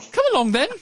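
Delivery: imitating nanny. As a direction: parrot the fussy nanny call. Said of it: "Like, his nanny".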